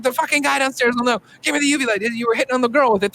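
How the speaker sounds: mock frantic investigator; impatient demand. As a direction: bark at the cop to hurry. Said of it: "He's like".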